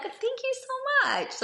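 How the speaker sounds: pleased woman answering a compliment, gushing thanks; delighted thanks. Retold or imitated. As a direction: gush with pleased surprise. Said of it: "like your necklace".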